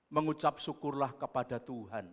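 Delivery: normal talking voice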